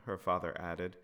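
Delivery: ordinary delivery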